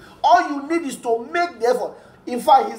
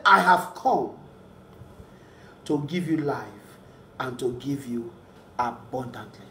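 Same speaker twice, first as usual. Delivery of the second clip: hushed quote; 9 semitones lower, 6 dB quieter than usual. Acted. Preacher quietly quoting Jesus to the congregation, hushed. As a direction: drop almost to a hush for Jesus' promise.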